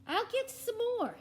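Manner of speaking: high, loud chirpy helper; eager cartoon voice. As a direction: offer it eagerly.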